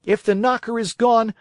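angry. Acted. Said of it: "blind with rage".

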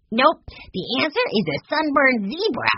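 put-on high-pitched, gleeful kid voice; little boy's punchline. A narrator's reading. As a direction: deliver the joke like a proud boy. Narrator voices Benny's punchline.

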